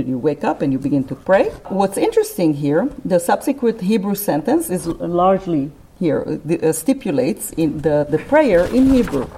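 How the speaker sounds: normal talking voice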